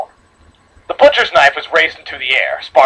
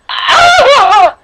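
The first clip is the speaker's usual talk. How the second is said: sudden high yelp of pain; pained cry; 6 dB louder than usual. Acted. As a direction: shriek as the blade hits. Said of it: "She let out another painful cry".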